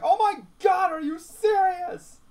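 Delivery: a replayed excited fan scream; retold shout. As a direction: scream it in disbelief. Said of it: "I shouted in the middle of the living room".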